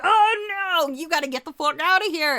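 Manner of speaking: hammed-up alarm, shooing them out; panicked warning. Woman telling the cops to flee, in mock panic.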